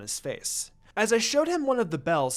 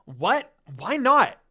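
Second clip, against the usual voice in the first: loud, higher outraged question; furious question; 4 semitones higher, 6 dB louder, slower than usual. A narrator's reading. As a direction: snap it out in anger. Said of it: "I furiously asked".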